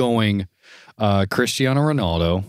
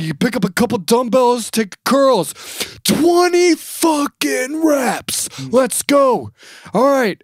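much higher, louder trainer yell; Ultimate Warrior imitation; 5 dB louder, slower than usual. Ultimate Warrior ordering curls, loud and high. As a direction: drive the kids hard, shouting. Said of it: "he's like".

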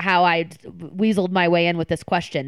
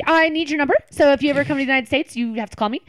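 higher, louder nervous rush; nervous, too fast; 8 semitones higher, 5 dB louder, faster than usual. Retold or imitated. Asking him for his number at a hurried goodbye, nervous and fast. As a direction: blurt it out in a mock-nervous hurry. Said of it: "my nervous thing where I'm talking too fast".

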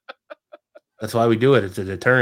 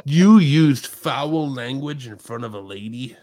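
mock prim scolding; 2 semitones higher, 8 dB louder than usual.

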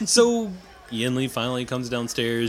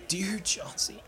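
soft unvoiced whisper of the dog's name; whispered grief; 6 semitones higher, 8 dB quieter, slower than usual. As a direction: breathe the name out in sorrow. Aldern grieving dog, whispered.

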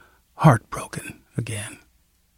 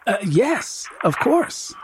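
a little higher, halting reply; stammered; 6 semitones higher, 3 dB quieter, slower than usual. Acted. Daughter answering the nurse, stammering. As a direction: stumble over the words. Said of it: "I stammered".

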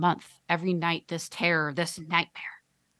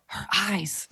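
choked, breathy horror; strangled whisper; 2 semitones higher than usual. Acted. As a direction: gasp the words out strangled. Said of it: "in a strangled voice, whispers".

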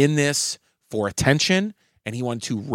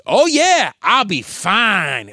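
mock heckler; 7 semitones higher, 6 dB louder, much slower than usual. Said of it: "And he goes".

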